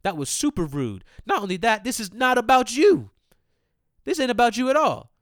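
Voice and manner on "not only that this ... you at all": angry outburst. Real, not acted. Ranting at a mom who walked off.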